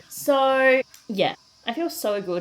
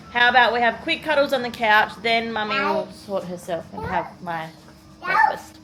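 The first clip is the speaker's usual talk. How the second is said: loud, high coaxing mum voice; 4 semitones higher, 10 dB louder than usual. Real, not acted.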